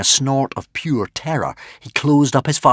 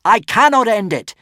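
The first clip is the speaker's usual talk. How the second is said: much higher, louder anguished yell; 13 semitones higher, 7 dB louder than usual. Acted. Hiccup yelling to the dragon in tears. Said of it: "yelled Hiccup".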